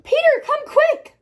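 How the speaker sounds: high, loud, urgent shout. Acted. Girl calling her brother to come see, high excited shout. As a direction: call brother in with a loud cry. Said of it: "I shouted".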